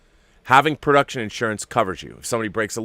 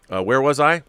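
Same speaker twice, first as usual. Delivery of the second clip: ordinary delivery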